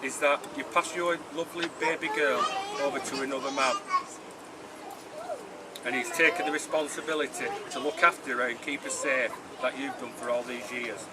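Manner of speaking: normal talking voice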